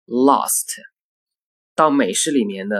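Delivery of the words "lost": British accent. Shown as a demonstration. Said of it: "在英式英语中发作".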